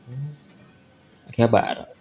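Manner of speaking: ordinary talk, nothing marked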